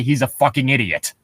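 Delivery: ordinary talk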